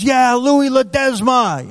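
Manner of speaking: high, slowed, eager; eager reveal. Retold or imitated. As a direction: draw out your full name proudly. Inmate repeats his name eagerly.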